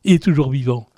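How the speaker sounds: normal talking voice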